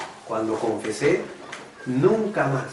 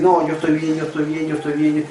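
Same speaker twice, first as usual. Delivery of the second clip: imitating a bitter person insisting they're fine; bitter denial; 3 semitones higher than usual. Bitter churchgoer brushing off help, mock denial. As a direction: mimic someone brushing off help. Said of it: "la persona amargada te va a decir".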